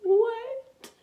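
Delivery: high, crying-out disbelief; stunned high-pitched what; shocked what. Retold or imitated. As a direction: gasp out the word in shock. Shocked at overheard remark.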